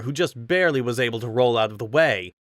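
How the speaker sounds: usual speaking voice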